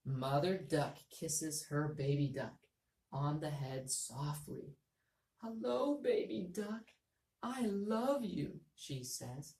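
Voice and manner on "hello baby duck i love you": higher, slow and tender; lovey voice. Shown as a demonstration. Teacher reading Mother Duck in a lovey voice. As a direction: coo it lovingly like a mother. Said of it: "my voice went to a lovey voice".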